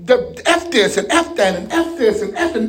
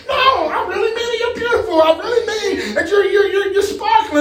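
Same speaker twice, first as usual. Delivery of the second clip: much higher, pleading voice; eager insisting; 8 semitones higher than usual. Pretend. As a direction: insist with a high eager lilt. Complimenter insisting, voiced by preacher.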